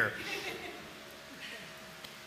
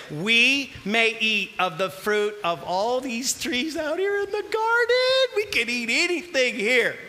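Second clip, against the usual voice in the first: Eve's voice; 3 semitones higher than usual. Acted. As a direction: answer the serpent like a naive Eve. Preacher voicing Eve to serpent.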